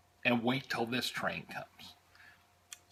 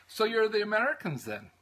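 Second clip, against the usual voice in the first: higher-pitched imitation of an Irish conductor's brogue; 9 semitones higher than usual. Conductor greeting American tourists on the train, Irish brogue. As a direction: do the friendly Irish conductor in an Irish accent. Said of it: "lovely brogue that I have".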